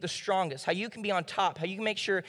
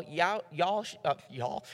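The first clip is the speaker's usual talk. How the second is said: slipping into Southern; 2 semitones lower, 4 dB quieter, slower than usual. Real, not acted. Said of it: "Sorry, my southerner came out".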